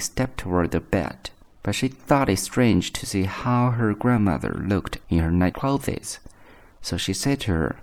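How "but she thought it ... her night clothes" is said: plain reading voice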